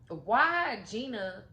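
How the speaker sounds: usual speaking voice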